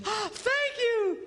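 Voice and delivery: high, slow squeal of thanks; mock wife squealing thank you; delighted wife. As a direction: ham up the wife's delight. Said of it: "she goes".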